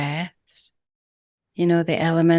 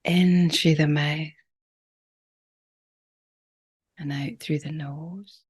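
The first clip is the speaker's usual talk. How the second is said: calm, unhurried breathwork guide with long pauses; gentle meditation leader, phrase by phrase; guided meditation. Real, not acted. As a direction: ease them into each breath, leaving lots of space.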